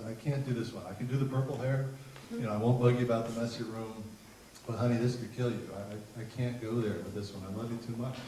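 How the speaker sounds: soft, subdued motherly plea; tender refusal. Retold or imitated. Mom takes a breath and refuses her daughter, tender refusal. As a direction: gently hold the line, voice dropped.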